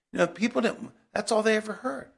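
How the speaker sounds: ordinary talk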